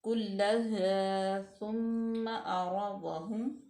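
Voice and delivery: slow melodic recitation; Quranic recitation. Shown as a demonstration. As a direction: chant it slowly like tilawat. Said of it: "recitation demo".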